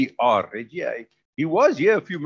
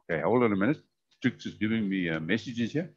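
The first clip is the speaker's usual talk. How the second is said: talking while chewing a sandwich; mouth full; 3 semitones lower, 6 dB quieter than usual. Real, not acted. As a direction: speak around a mouthful of food. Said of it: "She says I mustn't talk with my mouth full".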